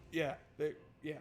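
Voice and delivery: ordinary talk, nothing marked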